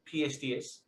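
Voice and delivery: slow Rhode Island "pierced ears", R's dropped; Rhode Island accent. Shown as a demonstration. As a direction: drag out "pee-est ee-iz" like a Rhode Islander. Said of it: "we don't pronounce".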